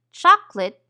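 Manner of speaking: "chocolate" in two syllables, CHOK-lit; dropped schwa syllable. Shown as a demonstration. Teacher showing the casual form of a word, schwa dropped. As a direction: say "chocolate" with the middle vowel left out. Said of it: "we usually eliminate the syllable".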